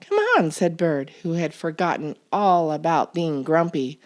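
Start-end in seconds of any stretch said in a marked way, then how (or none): 0.0-0.5 high, bright call to friends; cheerful call. A narrator's reading. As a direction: call them along, all happy now. Bird cheerful again.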